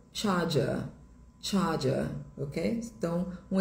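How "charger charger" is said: British "charger", no Rs, ending in "ah"; sotaque britânico. Shown as a demonstration. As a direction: drop both Rs in "charger", British style. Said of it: "Em inglês britânico". (sotaque britânico, sem pronunciar o R)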